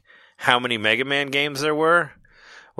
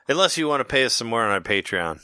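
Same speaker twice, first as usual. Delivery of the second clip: ordinary talk, nothing marked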